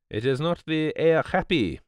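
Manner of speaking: put-on high, displeased foreign-accented voice; discontented Poirot. A narrator's reading. Poirot refuses to admire the Sphinx, discontented. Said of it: "Poirot looked at it discontentedly".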